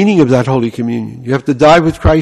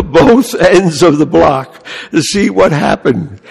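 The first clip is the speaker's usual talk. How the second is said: usual speaking voice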